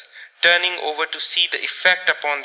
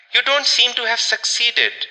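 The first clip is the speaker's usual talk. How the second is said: higher-pitched dry remark; 7 semitones higher than usual. A narrator's reading. Caroline remarking on her brother's failed search, dryly. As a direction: remark it with understated dryness. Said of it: "remarked Caroline dryly".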